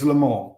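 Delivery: Le Mans in French, "luh MOH"; French pronunciation. Shown as a demonstration. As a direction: drop the final N and S of Le Mans. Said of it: "if you're speaking with a French accent, it's".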